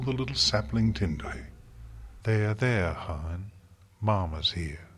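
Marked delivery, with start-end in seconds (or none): 2.2-4.9 tender, motherly cooing; soft coo. A narrator's reading. Farmer comforting a little sapling, soft coo. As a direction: soothe it softly like a little one. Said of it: "Her voice became a soft coo".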